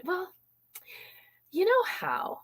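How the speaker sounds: dance it up with the inflection, high and soft; inviting you know how. Shown as a demonstration. Coach showing the hook opener, high and hushed. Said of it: "say it like that".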